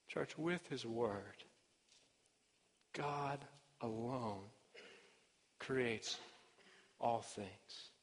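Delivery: measured, hushed appeal to the congregation; solemn sermon close. Real, not acted. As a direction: bring the sermon down to a quiet, weighty close. Preacher's solemn closing appeal.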